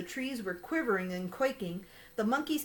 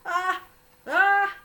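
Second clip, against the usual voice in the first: rising ah, ah before a sneeze, getting louder; high, louder gasping wind-up; sneeze build-up; 8 semitones higher, 8 dB louder than usual. Acted. Elephant about to sneeze, building high gasps.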